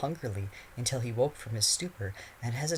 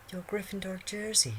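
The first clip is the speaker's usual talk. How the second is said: put-on high, sweet girl; coy girl voice; 10 semitones higher than usual. A narrator's reading. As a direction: repeat it sweetly. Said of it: "she repeated".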